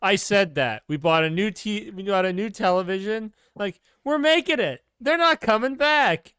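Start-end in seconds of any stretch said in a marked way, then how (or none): 4.0-6.3 put-on high, loud kid celebrating too early; imitating his younger self, thrilled and certain; excited twelve-year-old. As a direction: voice way up, crow it like an overjoyed child. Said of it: "me as a third or twelve-year-old child".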